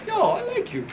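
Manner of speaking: high-pitched, put-on enthusiasm; mock delighted customer. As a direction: jump up high as an imitated, won-over customer. Imitating a won-over customer.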